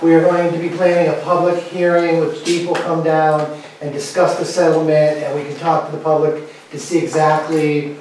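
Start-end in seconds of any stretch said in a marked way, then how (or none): none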